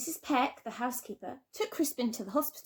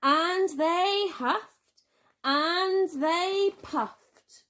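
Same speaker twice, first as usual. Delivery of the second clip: high, slow, drawn-out build; huffing and puffing; 8 semitones higher, 4 dB louder, much slower than usual. A narrator's reading. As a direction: blow each word out. Acting out huffing.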